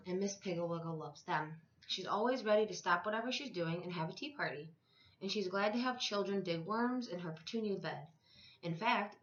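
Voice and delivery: ordinary delivery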